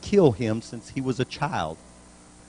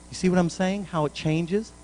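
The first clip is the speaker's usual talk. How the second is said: ordinary talk, nothing marked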